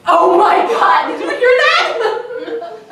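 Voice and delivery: loud, high, laughing astonishment; gushing amazement. Acted. Tourist amazed by her own slipped accent, gushing amazement. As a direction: stretch it out in thrilled, giggly disbelief.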